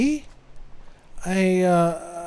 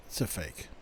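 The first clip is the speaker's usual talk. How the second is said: ashamed confession; 12 semitones lower, 15 dB quieter than usual. Acted. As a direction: say it much lower and much softer, barely audible. Boyfriend confesses the fake gift.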